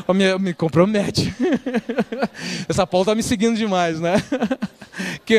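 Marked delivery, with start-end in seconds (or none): 1.4-2.6 short amused laugh; brief laugh. Real, not acted. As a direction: laugh along at the joke. Laughing at Paula joke.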